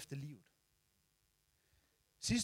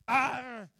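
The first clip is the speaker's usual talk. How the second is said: higher, strained battle yell; battle cry; 5 semitones higher than usual. Retold or imitated. Charging the attacking dog with a higher battle yell. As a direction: roar to scare off the dog. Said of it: "og så".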